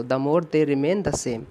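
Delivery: corrected sentence read out very slowly. Shown as a demonstration. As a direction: read the fixed version slowly. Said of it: "the more कर दो". (सुधरा वाक्य धीरे-धीरे)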